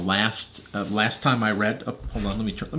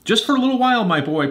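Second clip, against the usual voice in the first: high, loud storybook professor; professor character voice; 13 semitones higher, 7 dB louder, faster than usual. A narrator's reading. Professor Mazzicotti calling back to Arthur, high put-on voice. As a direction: call back in a piping, put-on voice.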